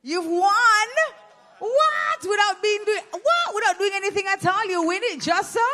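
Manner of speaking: high, loud mock disbelief; hammed-up "what?" at a lucky winner; mock astonishment. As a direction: act shocked that they won for nothing. Mock disbelief at winning teacup.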